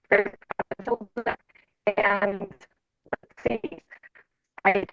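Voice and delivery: muffled underwater audio; bad mic, sounding underwater. Real, not acted. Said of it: "You sound like you're underwater".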